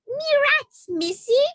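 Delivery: very high, loud yelping bat. Acted. The bat yelping at the girl for its rats, very high and loud. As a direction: yelp it out like a squeaky bat. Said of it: "it yelped".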